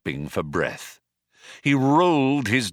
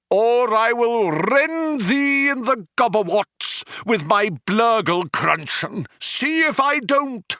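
very high, strident, louder threatening verse; horrible impassioned stridency; 3 dB louder than usual. Acted. The Vogon builds his poem to a strident shrieking climax. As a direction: climb to a shrieking pitch of passion. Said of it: "rising to a horrible pitch of impassioned stridency".

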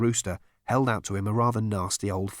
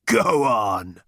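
loud taunting machine voice; tank voice; 7 dB louder than usual. Acted. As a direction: dare him, booming.

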